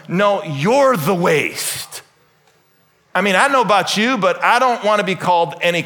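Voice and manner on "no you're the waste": slow, weighty "you're the waste"; firm rebuke. Acted. Jesus turning the accusation back on Judas, slow and firm. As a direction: lean hard on "you're" and draw it out. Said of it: "Jesus calls him".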